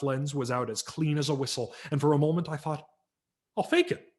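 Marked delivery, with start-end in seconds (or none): 3.6-4.1 put-on high, bright kid voice; a child hatching a plan; boy's scheme. A narrator's reading. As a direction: pitch up like a sneaky kid.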